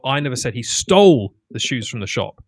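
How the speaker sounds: "stole" stretched and hit loud; stress on STOLE. Shown as a demonstration. Showing a different meaning by emphasis, stress on "stole". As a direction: punch the word "stole", easing off after it. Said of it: "they would emphasize different words".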